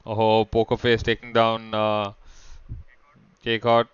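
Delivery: esports caster calling the fight; energetic shoutcaster commentary; live play-by-play. Acted. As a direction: call the action as it unfolds.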